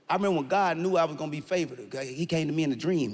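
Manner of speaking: usual speaking voice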